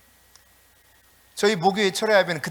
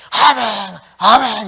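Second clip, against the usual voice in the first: raised, louder repeat of the congregational cry; imitated worship response, loud and higher; loud amen; 4 semitones higher, 10 dB louder, much slower than usual. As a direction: call it out firmly, a moderate shout. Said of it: "제가 말씀드린 것처럼".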